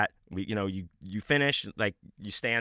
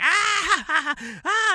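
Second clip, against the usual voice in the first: very high, loud, put-on giggling fit; imitated hysterical giggle; 6 dB louder than usual. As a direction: mimic a friend cackling in a stall. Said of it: "just laughing so".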